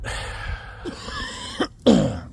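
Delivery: long rough coughing bout; coughing fit. Real, not acted. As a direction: keep coughing, struggling for air. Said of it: "sorry for all the coughing".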